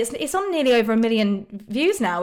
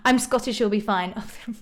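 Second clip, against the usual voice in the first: ordinary delivery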